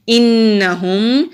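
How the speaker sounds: इन्नहुम with the doubled noon held and nasalised; nasal ghunna. Shown as a demonstration. Teacher demonstrating ghunna on इन्नहुम, slow and nasal. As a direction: stretch इन्नहुम slowly, humming the noon. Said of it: "नून मुशद्दद है, गुन्ना करेंगे".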